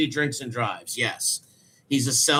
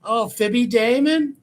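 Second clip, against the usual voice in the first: high incredulous ribbing; playful high-pitched taunting; mocking jab; 8 semitones higher, 4 dB louder than usual. Real, not acted. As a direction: tease him with mock disbelief. Mocking him as movie robber.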